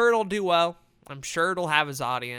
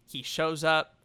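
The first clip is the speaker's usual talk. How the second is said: usual speaking voice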